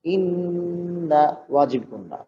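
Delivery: ইন্না with the nasal নুন held; nasal ghunna. Shown as a demonstration. Teacher showing the required ghunna on ইন্না. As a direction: let ইন্না hum through the nose before going on. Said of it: "নুনের উপর তাসদীদ".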